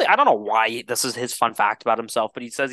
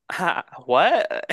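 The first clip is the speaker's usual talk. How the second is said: mock baffled; 5 semitones higher than usual. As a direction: sound totally thrown. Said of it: "the director was just like".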